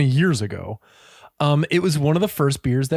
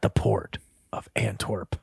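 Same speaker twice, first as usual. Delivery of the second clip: dramatic whisper; 8 semitones lower, 7 dB quieter than usual. Acted. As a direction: breathe it out like a secret.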